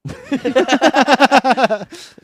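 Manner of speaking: loud, high burst of laughter; helpless high cackling; laughing. Real, not acted. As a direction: crack up long and loud. Reacting to the answer.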